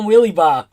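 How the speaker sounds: "wheelie bar" loudly said as "wheelie bah"; emphatic Boston "wheelie bar", bar ending in ah; R dropped. Shown as a demonstration. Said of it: "there's no R in".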